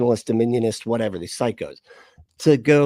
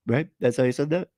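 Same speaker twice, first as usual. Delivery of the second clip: ordinary talk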